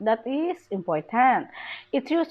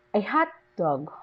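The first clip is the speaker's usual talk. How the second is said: "hot" stressed, falling on "dog". Shown as a demonstration. Teacher gives an adjective-noun example, stress on "hot". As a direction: give "hot" the main stress. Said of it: "The adjective takes the main stress".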